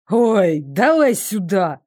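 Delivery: impatient snort. A narrator's reading. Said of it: "фыркнул Даррек".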